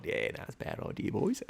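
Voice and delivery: whispered fast mouse voice; mouse backing out. Retold or imitated. Voicing the mouse.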